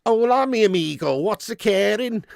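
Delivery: jokey Scouser speaking Spanish; Scouse Spanish. Acted. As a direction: greet a friend in Scouse-accented Spanish. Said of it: "Scouser's accent in a Spaniard would sound like".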